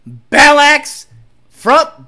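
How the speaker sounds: normal talking voice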